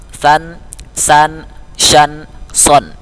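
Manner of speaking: "san, san, shan, son": lisped, hissed, hushed, then rounded; contrasting sibilant letters. Shown as a demonstration. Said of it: "huruf sod dia agak bulat".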